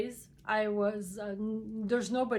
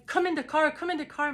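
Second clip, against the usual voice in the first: raised, urgent call to get in the car; urgent shouting; 6 semitones higher, 4 dB louder, faster than usual. Retold or imitated. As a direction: hurry him into the car, voice up high. Urging stranger into car after accident.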